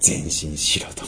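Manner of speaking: slow whispered go forward; whispered command. Acted. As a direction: hush it like a secret order. 神の命令を囁く. (ささやくように命令)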